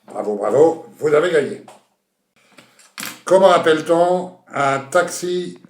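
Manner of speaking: usual speaking voice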